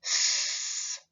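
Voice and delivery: long unvoiced hiss of S; a steady voiceless s sound. Shown as a demonstration. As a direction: hold a soft hissing S with no voice. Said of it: "Repeat after me".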